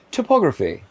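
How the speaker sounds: British-sounding typography, crisp and posh-free; English accent. Shown as a demonstration. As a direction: say typography the British way, with a rolled-up clipped vowel set. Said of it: "can't say without an English accent".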